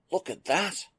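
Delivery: put-on like a kid spotting a new toy; an engineer marveling at a new starship; childlike delight. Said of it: "He spoke as a child perusing the latest toy".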